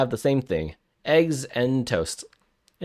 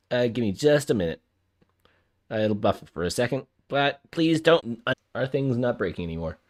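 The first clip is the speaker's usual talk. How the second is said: ordinary talk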